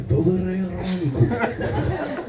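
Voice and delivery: slow, hushed, sweet good morning; tender drawn-out Polish greeting; soft morning greeting. Acted. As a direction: murmur it like to a lover in bed.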